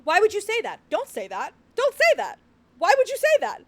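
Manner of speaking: a real cry of disbelief; exasperated outcry. Real, not acted. Player protests the prince's refusal, exasperated outcry.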